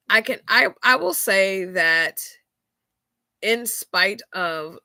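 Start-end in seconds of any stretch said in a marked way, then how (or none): none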